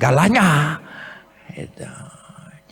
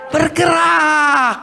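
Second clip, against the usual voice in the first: high, crying shout, slow and drawn out; shouted proclamation; 10 semitones higher, much slower than usual. Real, not acted. Preacher crying out to the congregation, slow, high shout. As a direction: belt "Bergerak" out as a crying preacher's call.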